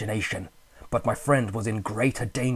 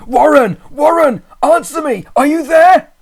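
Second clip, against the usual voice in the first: high, loud desperate shouts; screaming; 16 dB louder than usual. A narrator's reading. Narrator screaming down the telephone for his friend. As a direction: scream his name into the phone. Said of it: "called, shouted and screamed".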